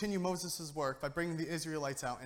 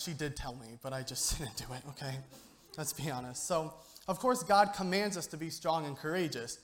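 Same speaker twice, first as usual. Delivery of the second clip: ordinary talk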